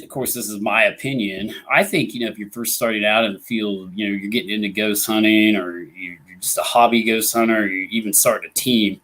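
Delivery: ordinary talk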